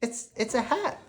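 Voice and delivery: put-on high, halting child's voice; shy little girl. A narrator's reading. Sophia presenting the hat in a high, halting child's voice. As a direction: stumble shyly over the words like a nervous kid. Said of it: "she says".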